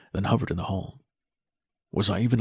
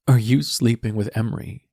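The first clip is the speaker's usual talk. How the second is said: demanding daughter; 3 semitones higher, 6 dB louder than usual. Acted. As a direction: demand the truth. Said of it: "she demanded".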